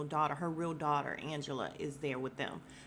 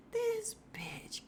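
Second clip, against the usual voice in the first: hushed, whispered aside in imitation; mock-scandalized whisper; whispered disbelief. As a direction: whisper it like an indignant thought. Aunt Lydia's silent look, voiced as thought.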